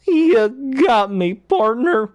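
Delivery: overplayed comic death; mock getting shot. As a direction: Jim Carrey character pretending to be shot, hammed up. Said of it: "Like when he gets shot".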